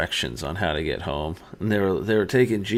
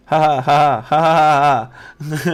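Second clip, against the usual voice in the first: loud, high staccato ha ha ha, imitating a maniacal laugh; 8 semitones higher, 10 dB louder than usual. Husband reenacting his drugged laugh for his wife, maniacal cackle. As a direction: cackle like a madman, hammed up. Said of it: "so it was like a maniacal laugh".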